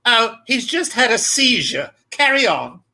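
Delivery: a touch of the drag queen's airy calm. Retold or imitated. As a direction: toss it off like a cool drag host. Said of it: "the Filipino drag queen said".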